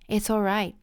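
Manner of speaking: normal talking voice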